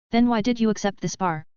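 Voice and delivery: ordinary delivery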